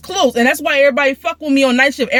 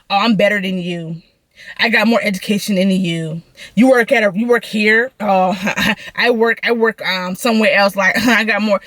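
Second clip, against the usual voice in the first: mock bougie lady; 5 semitones lower than usual. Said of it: "them bougie types that's just like".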